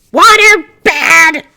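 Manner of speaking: loud, slow, high outraged yell; shout. Acted. Pumpkin spirit shouting at Gil from the towels, outraged. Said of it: "he shouted".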